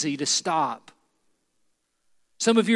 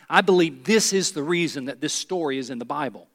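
ordinary talk, nothing marked

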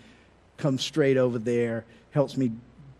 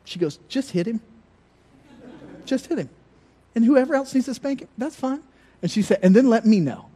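mom voiced higher, telling the teacher to be strict; stern mom; 7 semitones higher than usual. Mother telling the teacher to just hit him, higher and firm. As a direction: play his mother laying down the law, pitched up. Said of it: "she would say to the teacher".

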